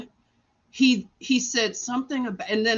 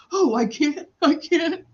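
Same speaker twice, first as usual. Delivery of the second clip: higher-pitched imitation of his protest; mock retort; 6 semitones higher than usual. Retelling the doctor's answer in the hallway, higher mock retort. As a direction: mimic him in a higher voice. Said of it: "And he said".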